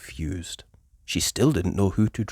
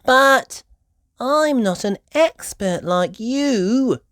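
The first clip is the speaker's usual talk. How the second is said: put-on high, slow little girl voice; a modest little piglet; Peppa's voice; 14 semitones higher, 6 dB louder, slower than usual. A narrator's reading.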